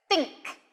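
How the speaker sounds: "Think" with too little air through the TH; weak TH. Shown as a demonstration. Teacher shows learners a mistake, "Think" with a weak airless TH. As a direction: barely push air on the TH of "Think". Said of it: "not be pushing the air through enough".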